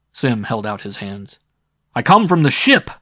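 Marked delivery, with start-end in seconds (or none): 1.9-2.9 shouting. A narrator's reading. Sim calls to crowd.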